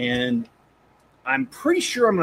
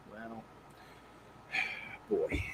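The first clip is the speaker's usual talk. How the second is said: whispered sigh; 4 semitones lower, 17 dB quieter than usual. Real, not acted. Sigh before reacting to story.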